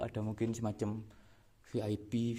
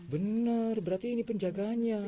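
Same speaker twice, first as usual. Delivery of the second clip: high, louder eureka; excited realisation; 10 semitones higher, 3 dB louder than usual. Real, not acted. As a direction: realise it with a jolt. Sudden realisation.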